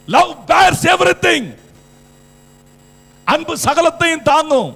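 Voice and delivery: high, loud preacher's shout; shouted proclamation. Real, not acted. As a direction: proclaim it at full force. Preacher proclaims the verse at full force. (உரத்த குரலில் முழங்குதல்)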